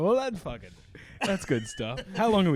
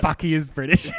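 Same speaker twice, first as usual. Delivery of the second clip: louder, struck by the realization; sudden realization; 3 semitones higher, 9 dB louder than usual. Retold or imitated. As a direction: blurt it out as if it just clicked. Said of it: "I'm like".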